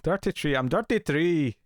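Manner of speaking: hammed-up Irish brogue, "Dirty tree" for thirty-three; a mock Irish boast, badly done; a clumsy mock Irish accent. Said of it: "I know I can't do it".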